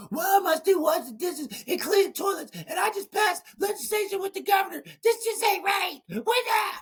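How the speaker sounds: high, crying shout of frustration to his wife; crying outburst. Retold or imitated. Venting to his wife from the parking lot, crying shout. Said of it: "I'm crying".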